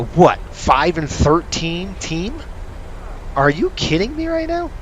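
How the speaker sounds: can't believe it; incredulous outrage. Real, not acted. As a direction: fan ranting about a losing team. Outraged hockey rant.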